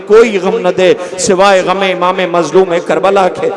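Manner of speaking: normal talking voice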